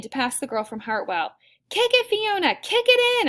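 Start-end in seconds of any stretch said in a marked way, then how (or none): 1.7-3.3 high, loud race-side cheer; urging shout. A narrator's reading. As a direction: yell to spur the runner on. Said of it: "I shout".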